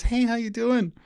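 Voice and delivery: high, bright-voiced young woman greeting; cheery girl at the door. Woman opening the door to a stranger, cheerful high greeting. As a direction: chirp the hello like a happy housewife.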